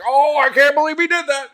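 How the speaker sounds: high, put-on shocked meme voice; mock disbelief in a borrowed voice; meme guy voice.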